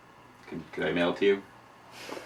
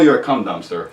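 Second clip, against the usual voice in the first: ordinary talk, nothing marked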